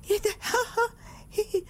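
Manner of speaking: high whimpering mock cry; mock sobbing. Woman shocked at her angry face, mock crying high. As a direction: fake a high little sob at yourself in the mirror. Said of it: "هتلاقي نفسك".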